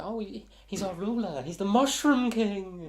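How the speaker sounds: high, drawn-out gnome voice; reverent gnome. Acted. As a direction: reveal the ruler in a high little gnome voice. Gnome names his ruler with awe.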